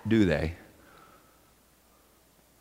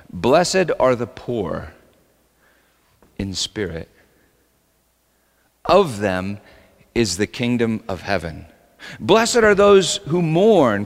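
scripture reading; 7 semitones higher, 3 dB louder than usual. Acted. Pastor reading the Beatitudes to his church, solemn and measured. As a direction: read it out like holy text, unhurried.

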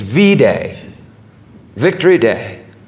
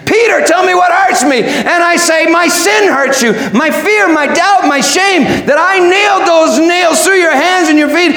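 high, impassioned cries back and forth; full-force preaching; 12 semitones higher than usual. Acted. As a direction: preach the confession at a fervent high pitch. Preaching at full force, acted dialogue.

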